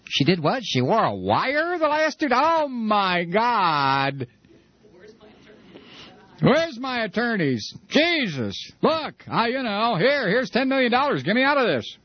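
high, rushed desperate bargaining; mock terror, begging for lawyers; panicked pleading. Playing Rush, panic at wiretap.

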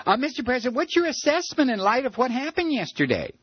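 high, fast press-question voice; reporter voice. Retold or imitated. Imitating a reporter asking Bush.

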